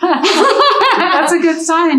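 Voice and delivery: laughing. Real, not acted. Laughing at winded host.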